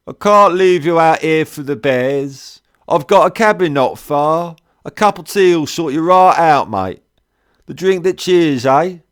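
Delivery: loud, high cockney cheer; thick cockney. A narrator's reading. Trader offers tea in loud, high cockney. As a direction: boom it out like a jolly London trader. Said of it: "in that thick London cockney".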